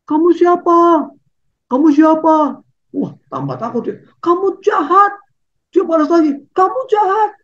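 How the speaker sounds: put-on high, child-like voice, growing scared; frightened child. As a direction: play a scared little boy. (suara anak kecil yang ketakutan)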